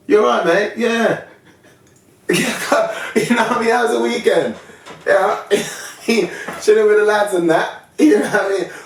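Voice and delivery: high, loud matey lad impression; chummy British bloke voice. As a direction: play the eager-to-fit-in workmate. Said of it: "When I used to work in Pinewood".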